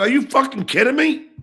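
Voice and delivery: high, disbelieving angry shout; retold outrage. Retelling his reaction to the played tape, outraged. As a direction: throw the line up in incredulous anger. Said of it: "I go".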